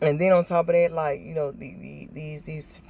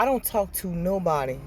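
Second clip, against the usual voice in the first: usual speaking voice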